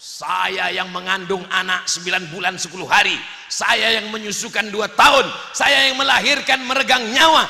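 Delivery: mounting scolding, acted out; acted angry wife. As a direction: rant as an outraged mother, rising in volume. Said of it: "Dia malah ngamuk".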